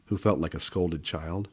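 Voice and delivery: ordinary delivery, nothing marked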